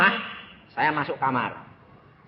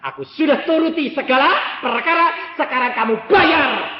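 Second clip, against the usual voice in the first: a hint of a commanding God, higher and louder; lightly voiced divine demand; God's voice; 10 semitones higher, 9 dB louder than usual. Preacher voicing God demanding repayment, lightly commanding. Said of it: "kalau Tuhan mengatakan".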